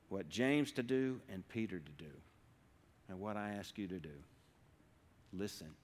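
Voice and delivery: ordinary talk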